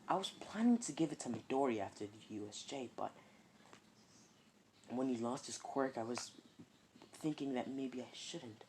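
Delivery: inner thoughts. Acted. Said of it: "process of thinking is like".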